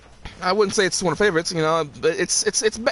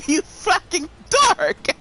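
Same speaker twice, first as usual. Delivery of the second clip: laughing yell; 7 semitones higher, slower than usual. Real, not acted. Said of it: "repeated louder insult".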